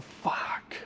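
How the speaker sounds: a breathless whispered curse; whispered curse after being thrown out; whispered despair. Retold or imitated. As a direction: breathe it out, defeated. Told to leave again, despairing.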